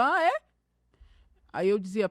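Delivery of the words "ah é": high, loud surprised "Ah, é?"; mock surprise. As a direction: feign surprise, pitched way up. Her mock surprise, quoted.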